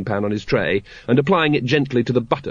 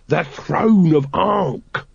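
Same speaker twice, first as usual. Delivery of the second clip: slow emphatic; 5 semitones higher, much slower than usual. Acted. Throat explaining slowly.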